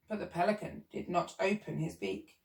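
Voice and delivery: usual speaking voice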